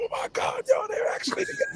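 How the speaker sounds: high-pitched gushing squeal; fangirl squeal. Retold or imitated. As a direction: squeal like an excited fan. Fans gushing over rumored couple.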